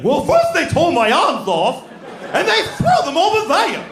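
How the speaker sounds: wildly over-the-top, high, wailing impression; Ray Bolger's Scarecrow. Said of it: "this performance that's way over the top".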